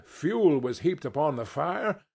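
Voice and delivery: ordinary delivery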